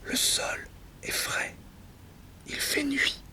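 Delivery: hushed, voiceless whisper. Acted. Speaker confiding where they are on the floor, whispered. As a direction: whisper it as a private aside.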